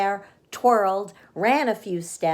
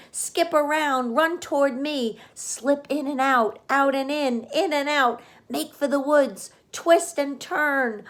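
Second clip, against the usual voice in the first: high-pitched goose voice, rapid commands; goose giving orders; 7 semitones higher than usual. A narrator's reading. The goose giving Wilbur orders, high goose voice. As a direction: coach him on like a bossy goose. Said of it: "began to give orders".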